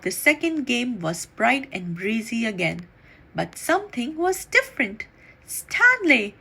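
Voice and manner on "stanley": high, louder cry of shock; shocked gasp. A narrator's reading. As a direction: blurt his name in alarm. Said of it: "gasped Missus Lambchop".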